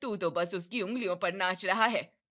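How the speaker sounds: loud scolding mother; angry. Acted. Mother scolding son for obeying his wife, angry and loud. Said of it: "कमला गुस्से से कहती है".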